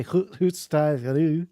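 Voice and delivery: slow, hammed-up Scottish gibberish; mock Scottish. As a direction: play up a cartoon Scottish accent. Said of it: "I don't want to say".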